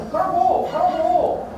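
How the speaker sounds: loud, high, furious yell of go; angry shout. Retold or imitated. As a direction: hurl the words as an order to leave. Wife tells husband to leave.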